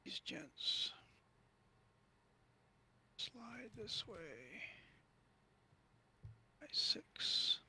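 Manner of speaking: whisper. Real, not acted. Whispering while moving pieces.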